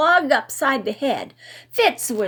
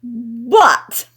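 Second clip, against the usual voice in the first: loud; 8 dB louder than usual. A narrator's reading. Drunk Riley speaks.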